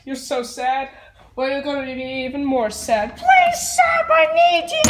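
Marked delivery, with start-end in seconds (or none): 3.2-4.8 high, desperate begging cry; pleading wail. Real, not acted. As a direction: beg through tears not to be sent away. Child sent to room, pleading.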